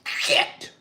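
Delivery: sharp hissed curse, mock shock; imitated freezing-cold expletive; involuntary cold-shock cuss. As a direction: blurt it as if icy air just hit you. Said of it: "cold that makes you cuss involuntarily".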